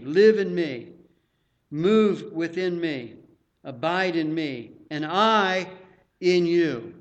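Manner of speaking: loud, slow declaiming of scripture; scripture reading. Acted. Preacher reads Jesus' command to his disciples, emphatic scripture reading. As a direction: preach each phrase out with force.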